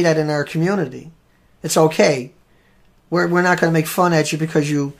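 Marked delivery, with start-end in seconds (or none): none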